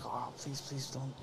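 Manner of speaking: very soft, low retold pleading; quiet, dreading aside; hushed plea. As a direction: beg under your breath. Silent inward plea, retold.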